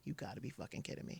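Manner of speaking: breathless whisper of shock, almost no voice; a stunned whisper as her world collapses; whispered disbelief. Retold or imitated. On the hospital floor hearing he died, a shocked whisper.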